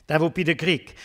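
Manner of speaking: normal talking voice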